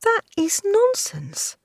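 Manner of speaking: high, slow frightened protest; whimpering protest. Acted. Maid whimpers a protest to her lord. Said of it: "Hinkle whimpered".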